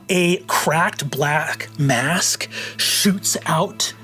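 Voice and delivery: ordinary talk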